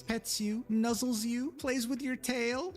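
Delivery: high, slow and cutesy, far up; cute furry roleplay voice. Retold or imitated. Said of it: "they would literally say to themselves".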